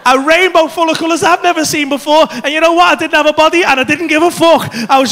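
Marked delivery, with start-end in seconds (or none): none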